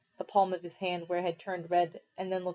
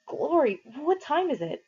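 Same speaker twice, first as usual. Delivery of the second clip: startled; 8 semitones higher than usual. Acted. Startled awake late.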